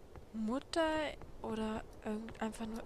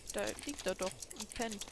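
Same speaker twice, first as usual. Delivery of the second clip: normal talking voice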